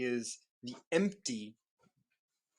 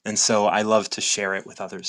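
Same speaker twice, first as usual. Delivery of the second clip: ordinary talk, nothing marked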